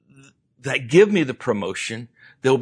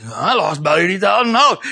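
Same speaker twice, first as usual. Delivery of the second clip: laughing confession; 6 semitones higher, 5 dB louder, faster than usual. Retold or imitated. Jack's confession retold.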